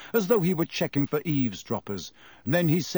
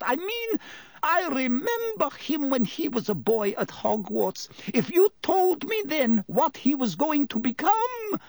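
musing landlady; 13 semitones higher than usual. Acted. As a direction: reminisce in disbelief, high voice. Voices Rossmerter reminiscing.

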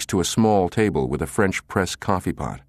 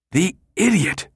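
high-pitched burst of exasperation; seething sputter; 12 semitones higher than usual. A narrator's reading. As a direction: sputter it out, fuming. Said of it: "Midge sputtered, seething".

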